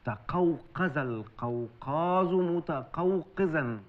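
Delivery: تقوقز القوقاز متقوقزا said very slowly, each syllable spaced; slow demo. Shown as a demonstration. Father slowly models the twister for his daughter. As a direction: spell out the twister slowly so she can repeat it. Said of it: "قولي ورايا".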